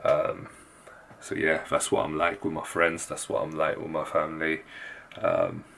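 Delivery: hushed so sleepers stay asleep; soft, low late-night voice; keeping voice low. Real, not acted. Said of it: "I'm gonna have to keep my voice very low".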